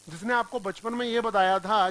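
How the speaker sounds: ordinary delivery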